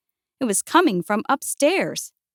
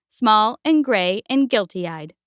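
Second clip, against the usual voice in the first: ordinary delivery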